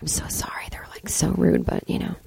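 whispered apology. Pretend. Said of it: "be like".

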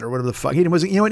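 usual speaking voice

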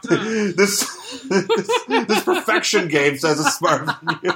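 real giggles breaking into the words; laughing. Real, not acted. Riffing on toys outsmarting the hero, laughing. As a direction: try to get the joke out through laughter.

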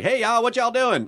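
put-on generic Southern accent. Actor plays a stock Southern guy, in a hammed-up Southern accent. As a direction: play a stock Southern guy greeting folks. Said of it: "quote, Southern guy and you were like".

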